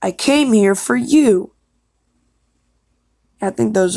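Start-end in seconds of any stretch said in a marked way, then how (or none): 0.0-1.5 higher, swooping dramatic delivery; audition line. Acted. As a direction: push CAME and YOU up high. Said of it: "I'm not really bold".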